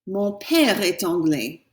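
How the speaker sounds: teacher repeating the model; slow French repeat. Shown as a demonstration. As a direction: repeat for students to copy. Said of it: "How about".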